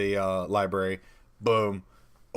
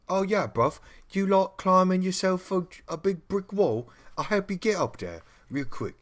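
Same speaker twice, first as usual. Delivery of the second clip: much higher, hammed-up Cockney lad; chirpy Cockney helper offering a leg up; Cockney accent; 11 semitones higher than usual. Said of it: "because he's Cockney".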